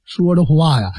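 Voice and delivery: ordinary delivery, nothing marked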